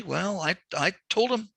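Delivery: ordinary talk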